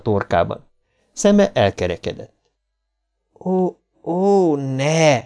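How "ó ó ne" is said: dread. A narrator's reading. Bill in dread.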